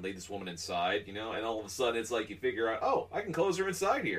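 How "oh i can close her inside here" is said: cheeky goose. Retold or imitated. Voicing the goose's thought.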